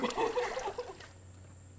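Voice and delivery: high breathless laugh after cold shock. Real, not acted. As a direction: laugh through the icy shock. Just doused with ice water.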